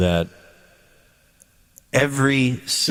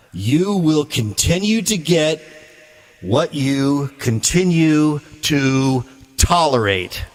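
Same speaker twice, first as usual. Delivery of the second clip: slow, deliberate, every word weighted; slow emphatic repeat; 3 semitones higher, slower than usual. Shown as a demonstration. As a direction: drive the warning home slowly. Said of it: "I'll repeat it one more time".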